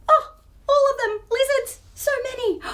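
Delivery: high, bright gushing wonder; high delighted squeal. Acted. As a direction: squeal over all the animals. Reader delighted at animals.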